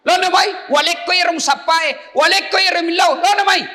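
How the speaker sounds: high, loud pulpit voice; fiery preaching. Acted. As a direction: preach it with full force. Preaching at full force.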